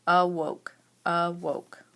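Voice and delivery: awoke broken into a and woke; split by syllable. Shown as a demonstration. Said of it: "break the word into chunks".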